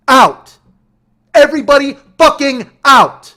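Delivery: very high, very loud shouted commands; screamed eviction. Retold or imitated. Driver screams at everybody to leave, furious. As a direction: explode on each word.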